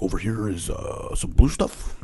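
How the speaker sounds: soft, slow, dry teacher voice; lightly voiced teacher; teacher impression. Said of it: "do the impression of him".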